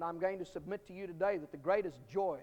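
normal talking voice

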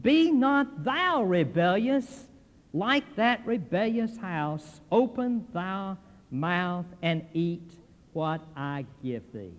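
slow, solemn reading of scripture. Acted. Preacher reading the verse to the congregation, slow and solemn. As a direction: draw each word out like holy writ.

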